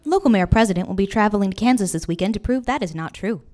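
loud confident anchor read; news read. Acted. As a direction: deliver it like evening news. News report parody.

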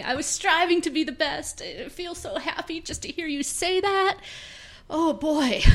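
put-on eager little kid. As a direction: play a thrilled six-year-old. High eager kid character.

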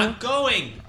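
high call from off. Acted. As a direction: call from another room. Father calls from off.

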